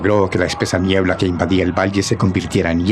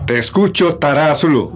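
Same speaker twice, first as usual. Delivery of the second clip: calling out defiantly; 7 semitones higher than usual. Acted. Héroe grita hacia los muros.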